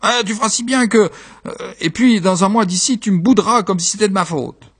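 high, protesting wife; reproachful wife. Acted. Wife reproaches her husband.

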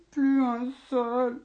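slow, mournful ogre lament. Acted. Ogre lamenting to his doctor, slow and mournful. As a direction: moan it like a hungry ogre. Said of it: "se lamenta l'ogre".

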